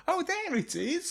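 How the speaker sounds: put-on high, slow children's show voice; Dora. Co-host imitates Dora finding the thing, high slow voice. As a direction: mimic a presenter's staged discovery. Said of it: "and then goes".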